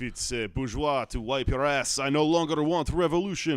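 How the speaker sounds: put-on French accent. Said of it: "I don't know why I did a French".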